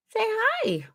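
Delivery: high, soft coo to a little one. Real, not acted. Mother urging her baby to greet viewers, high soft coo.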